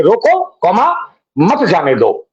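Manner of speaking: रोको held and rising, then मत जाने दो falling together; pause after रोको. Shown as a demonstration. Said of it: "comma का कमाल देखिए". (रोको के बाद विराम, फिर मत जाने दो एक साथ)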